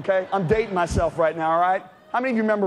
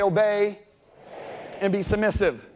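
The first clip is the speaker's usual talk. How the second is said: call for repetition; slower than usual. Shown as a demonstration. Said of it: "Everybody say".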